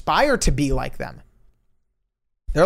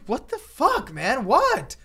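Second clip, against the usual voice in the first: startled confusion; 9 semitones higher, 3 dB quieter, much slower than usual. Retold or imitated. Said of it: "And I was like".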